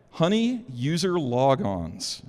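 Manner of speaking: normal talking voice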